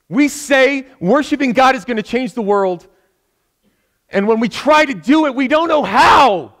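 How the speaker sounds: full-force sermon delivery; fiery preaching. Acted. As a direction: drive the point home to the congregation, slowing on "we don't know how".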